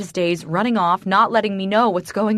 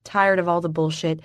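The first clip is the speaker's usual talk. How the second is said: ordinary delivery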